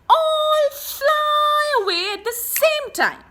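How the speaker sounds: high and loud sudden lift; high, loud storytime excitement; excited burst. A narrator's reading. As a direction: let it fly up with the birds. Birds burst away, voice jumps.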